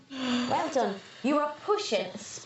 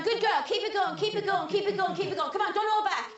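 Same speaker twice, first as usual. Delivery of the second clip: much higher, laughing encouragement; excited coaching; 7 semitones higher than usual. Real, not acted. Midwife cheering the mother as the baby crowns, much higher. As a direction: cheer her over the finish.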